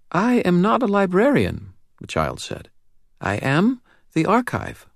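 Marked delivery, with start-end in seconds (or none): 0.1-1.7 put-on high, serious child's voice with a faint British lilt; serious little girl, faint British accent. A narrator's reading. Narrator voicing the girl to Dresden, serious and high. 3.2-4.9 put-on high, serious child's voice with a faint British lilt; serious little girl, faint British accent. A narrator's reading. Narrator voicing the girl to Dresden, serious and high.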